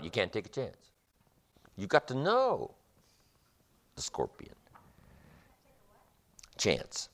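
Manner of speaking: ordinary talk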